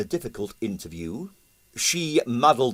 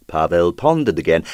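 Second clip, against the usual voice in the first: ordinary delivery, nothing marked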